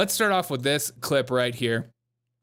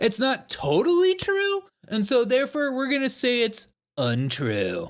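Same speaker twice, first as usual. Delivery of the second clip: mocking fact checker; 9 semitones higher than usual. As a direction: parody a prissy fact checker up in head voice. Mimics the fact checkers' reasoning.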